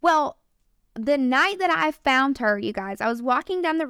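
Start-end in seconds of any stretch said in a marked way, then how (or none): none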